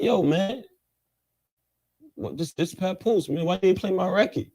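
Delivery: high, indignant mock complaint; put-on indignant demand; retold confrontation. Acts out confronting the DJ by phone.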